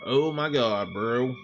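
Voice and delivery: usual speaking voice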